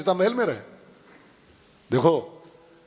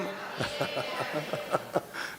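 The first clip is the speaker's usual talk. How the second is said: breathy laugh; 10 dB quieter than usual. Real, not acted. Preacher laughs mid-sermon.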